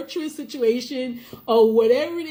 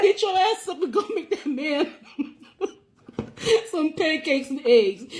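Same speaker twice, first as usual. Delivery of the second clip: laughing mock scolding; 2 semitones higher than usual. Host mock-ordering a wife to cook, laughing. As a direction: scold playfully until the laugh takes over.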